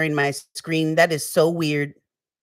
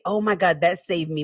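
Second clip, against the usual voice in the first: ordinary talk, nothing marked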